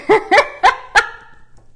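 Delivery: a loud high burst of laughter; high bright laugh. Real, not acted. Laughing at angry emojis.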